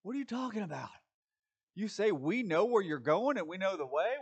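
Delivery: lightly voiced incredulous friend; a hint of puzzled disbelief, rising; baffled disciple. Preacher voicing his own reaction to Jesus, mildly incredulous. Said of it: "I'm like".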